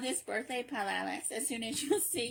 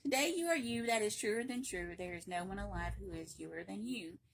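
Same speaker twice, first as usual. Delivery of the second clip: ordinary delivery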